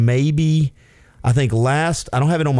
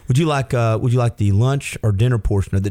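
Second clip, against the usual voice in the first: ordinary talk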